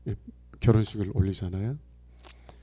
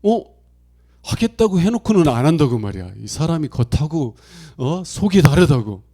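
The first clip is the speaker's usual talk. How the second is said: louder, higher indignant Seoulite; exasperated griping about broken promises; irritated Seoul listener scolding; Seoulite complaint; 4 semitones higher, 9 dB louder than usual. Retold or imitated. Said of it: "이 서울 분들은".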